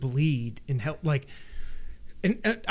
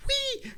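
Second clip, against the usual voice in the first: high mock "wee"; tiny high-pitched imitated cry; mimicked jumper's squeal; 4 dB quieter than usual. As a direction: squeal it lightly, like someone leaping. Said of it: "he jumps and he goes".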